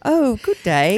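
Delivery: lightly put-on British accent. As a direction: say it like a posh Brit. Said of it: "let's both do our British accents".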